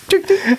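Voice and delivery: laughing high tuk-tuk honk; horn imitation. Refusing then honking anyway for the co-host, laughing high honk. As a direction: squeeze out the horn through a laugh. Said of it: "Because you asked me to do it".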